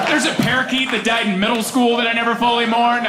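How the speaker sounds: usual speaking voice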